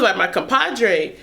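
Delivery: ordinary delivery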